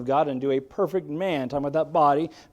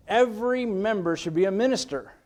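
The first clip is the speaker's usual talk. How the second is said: raised, higher-pitched preaching; emphatic declaration; 6 semitones higher than usual. Real, not acted. Preacher declares his heartfelt belief to the church, emphatic. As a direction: proclaim it as a firm conviction.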